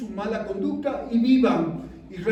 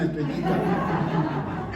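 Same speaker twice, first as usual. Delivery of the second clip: slow laughing name; 4 semitones lower, much slower than usual. Shown as a demonstration. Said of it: "sino en la mañana".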